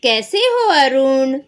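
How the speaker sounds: put-on a doctor gently teasing a sick boy; kind doctor. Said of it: "देखो वैद्य जी क्या बोलते हैं". (प्यार से पूछते वैद्य जी)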